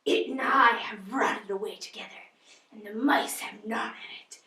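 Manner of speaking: put-on soft eerie whispering old lady; haunt them with bitter whispers; Miss Havisham. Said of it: "Miss Havisham".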